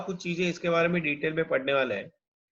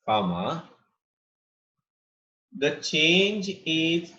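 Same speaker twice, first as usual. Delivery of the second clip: slow dictation in short phrases; 2 semitones lower than usual. Real, not acted. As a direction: leave long gaps for students to write. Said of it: "कितना slow बोल रहा हूँ यार मैं फिर भी".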